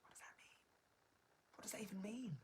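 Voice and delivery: baffled whisper. Real, not acted. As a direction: whisper it, puzzled. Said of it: "puzzled about a comment".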